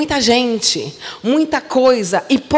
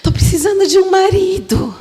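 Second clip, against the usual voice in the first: mock needy woman; 6 semitones higher than usual. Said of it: "toda carente fica falando".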